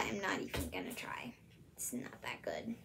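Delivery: fast embarrassed whisper; soft self-conscious aside; whispered giving up. Real, not acted. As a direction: back out under your breath. Gives up on the British accent.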